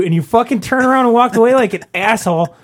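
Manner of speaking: ordinary talk, nothing marked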